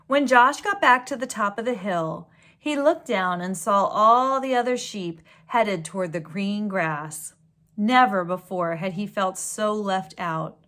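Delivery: gentle storybook read-aloud; story reading. A narrator's reading. Reading a picture book to children, storybook voice. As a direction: read it like a bedtime story to kids.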